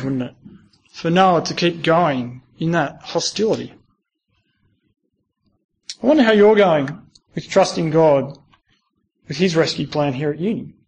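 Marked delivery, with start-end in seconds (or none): none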